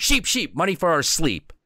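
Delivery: put-on high, sing-song boyish rhyme; rhyming kid. Viking boy chanting a rhyme at the sheep, sing-song. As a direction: chant it proudly like a magic spell. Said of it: "and he goes".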